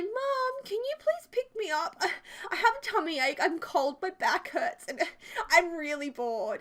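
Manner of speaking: put-on childish whine with a giggle; whiny child. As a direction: plead like a sick kid wanting home. Phoning mum to be picked up.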